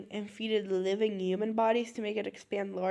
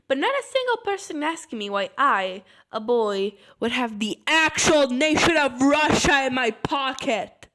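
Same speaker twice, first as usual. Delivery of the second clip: loud, higher, building to near shouting; incredulous, loud complaint; exasperated rant; 3 semitones higher, 7 dB louder than usual. Acted. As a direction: raise your voice in comic indignation. Exasperated rant about Russia.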